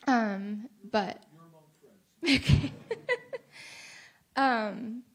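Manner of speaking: teary shaky breath and laugh; a wet, trembling laugh-sigh. Real, not acted. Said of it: "I'm gonna try not to cry".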